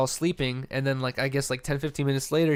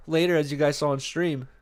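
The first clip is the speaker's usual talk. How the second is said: normal talking voice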